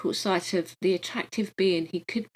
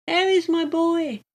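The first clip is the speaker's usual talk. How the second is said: ordinary delivery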